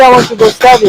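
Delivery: high mocking girlfriend whine; mimicking girlfriend. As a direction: lightly mimic her sweet excuse. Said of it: "she always say".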